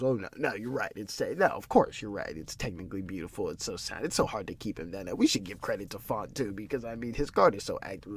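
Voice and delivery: soft, sarcastic mock agreement; a lightly voiced, sneering commentator; mocking condescension. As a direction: mimic him patronizing the critic. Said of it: "He wants to be funny man now".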